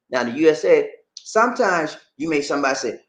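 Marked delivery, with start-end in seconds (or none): none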